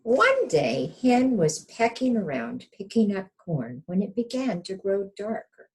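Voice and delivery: put-on lively read-aloud for children; storybook narration. A narrator's reading. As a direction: tell it like a picture book to little ones. Reading picture book to children.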